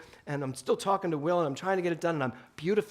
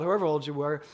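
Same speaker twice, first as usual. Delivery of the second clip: ordinary talk, nothing marked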